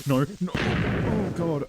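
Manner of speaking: loud, high panicked cry. Real, not acted. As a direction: yell out in sudden alarm. Startled by an attack in game.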